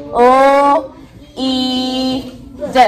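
each phoneme held separately: "O", "E", then short "D"; sounding out a word. Shown as a demonstration. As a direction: stretch out "O" and "E", cut "D" short. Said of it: "First sound".

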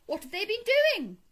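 high, loud anxious question; alarmed cry. A narrator's reading. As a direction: demand the news in alarm. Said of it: "cried Toad, trembling in every limb".

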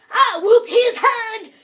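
slow, loud, high hammed-up American drawl whooping; mock American accent. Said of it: "'Cause I'm an American".

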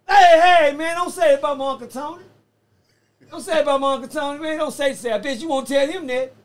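hammed-up loud protest; mock angry defense. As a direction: play the guy sticking up for his uncle. Acted defense of Uncle Tony.

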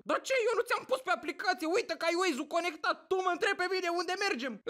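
high-pitched mock indignation; irritated outburst. Toothache passenger berating Uber driver, mock irate. Patient snaps at taxi driver.

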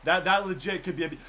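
ordinary talk

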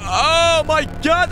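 ordinary talk, nothing marked

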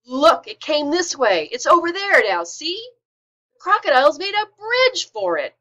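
loud, high squealing boar cry; frantic boar yelling the alarm; wild boar scream. A narrator's reading. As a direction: screech it out like an angry wild boar. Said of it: "wild boar scream".

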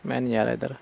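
low, soft aside to self; low refusal. Real, not acted. Player backing away from the dark room, low quiet refusal. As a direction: refuse under the breath, voice dropped.

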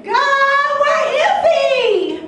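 loud, high, long-drawn wail; desperate outcry. Acted. Single woman begging God for a man, desperate shout. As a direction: cry it out to heaven, stretching every word.

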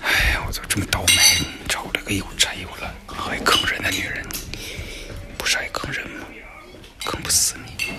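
resentful whispered aside; whispered grumble. Acted. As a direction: mutter it bitterly under your breath. Private grumbling aside in a skit.

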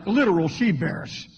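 ordinary delivery

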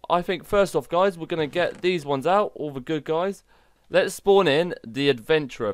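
ordinary delivery

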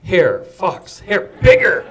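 loud, punchy voicing of a wolf choosing prey; hammed-up wolf sizing up its options; wolf's thoughts. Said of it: "you see this wolf go".

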